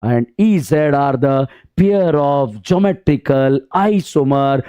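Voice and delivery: drawn-out, very slow summing up. Real, not acted. Teacher to students, very slow emphatic summary. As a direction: spell out each word slowly. Teacher concluding definition slowly.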